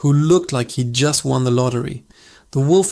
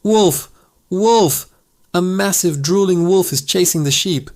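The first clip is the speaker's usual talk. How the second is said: panicked scream; 4 semitones higher than usual. Acted. Shepherd boy screaming to the village, faked panic. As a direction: cry wolf as if truly panicked. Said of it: "screamed towards the village".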